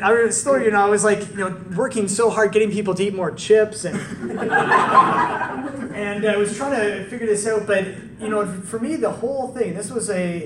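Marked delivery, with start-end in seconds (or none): 4.0-5.9 high burst of laughter. Real, not acted. Preacher laughing at his chip-selling past, high laugh. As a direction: laugh at yourself.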